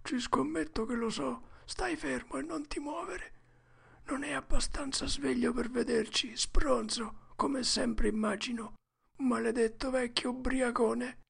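high, breathy secretive whisper; hushed boy Huck. A narrator's reading. Huck warns Tom to lie still, hushed. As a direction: whisper it high to your friend.

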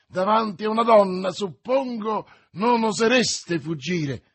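loud, high taunt; defiant challenge. A narrator's reading. D'Artagnan dares the stranger before a lady, defiant challenge.

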